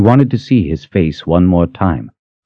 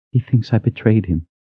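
higher voiced, hurt statement; wounded, raised-pitch admission; pained disbelief; 6 semitones higher, 3 dB quieter than usual. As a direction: say it high and hurt, still voiced. First, voiced take of repeated grief.

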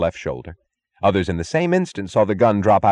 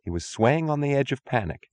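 ordinary delivery, nothing marked

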